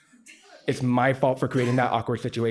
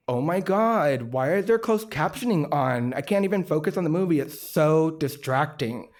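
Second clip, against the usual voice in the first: exasperated complaint; 4 semitones higher than usual. Retold or imitated. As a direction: imitate a put-out moviegoer, stretching the key words. Retold moviegoer complaining about captions.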